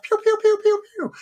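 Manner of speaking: high, mock pew-pew blaster noises; laser sound effects. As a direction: fire off cartoon laser shots.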